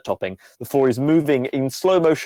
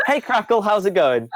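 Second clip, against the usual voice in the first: high-pitched, breezy computer greeting; chirpy computer character; 7 semitones higher than usual. Acted. Computer greets a player, chirpy and high. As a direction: pitch up as the all-seeing computer.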